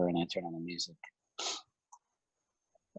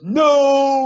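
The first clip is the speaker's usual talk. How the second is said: sharp high yelled No; a big brother's outraged no; loud no; 7 dB louder than usual. Retold or imitated. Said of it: "He goes".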